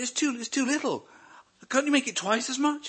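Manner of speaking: loud, high, delighted exclamation; beaming with joy over the bill; overjoyed. Retold or imitated. Joyful reply to the mechanic.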